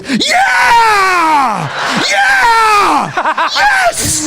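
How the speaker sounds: high shouted cheers breaking into a laugh; cheering. Retold or imitated. The whole train cheering the man who got his phone back. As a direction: whoop like the crowd after a winning goal. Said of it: "everyone on the train just goes".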